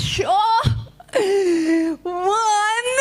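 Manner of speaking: high, squeaky confident bunny; rabbit counting. Acted. Rabbit starts counting mud hills for Anansi, high squeal. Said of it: "So I says".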